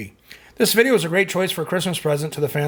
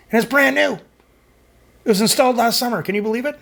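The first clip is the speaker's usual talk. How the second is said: higher-pitched real disbelief; incredulous; 7 semitones higher than usual. Real, not acted. Host complains to viewers about the new air conditioner breaking, incredulous. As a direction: protest in a raised, incredulous pitch.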